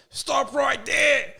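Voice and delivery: high, drawn-out shouted command; police shout. Retold or imitated. Police ordering the driver to stop, shouting. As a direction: shout it like a cop halting a suspect.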